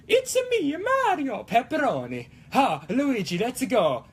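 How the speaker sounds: high-pitched cartoon Italian plumber; hammed-up Italian accent, starting high then dropping; Mario. Opening the show as a game plumber, Mario voice. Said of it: "It's gonna be really hard to keep this accent".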